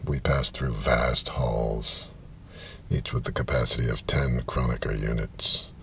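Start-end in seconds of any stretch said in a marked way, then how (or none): none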